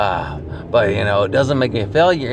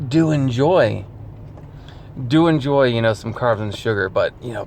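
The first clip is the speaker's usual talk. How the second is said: ordinary delivery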